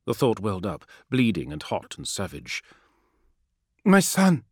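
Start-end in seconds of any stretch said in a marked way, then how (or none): 3.8-4.4 high, loud anguished cry; blurted aloud. A narrator's reading. Mother crying out for her son to the assassin, high and loud. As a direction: it bursts out before she can stop it. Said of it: "She said the words aloud before she could help herself".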